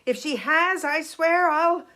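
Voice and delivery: angry father. Acted. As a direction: demand answers like a furious protective father. Father threatens over his son.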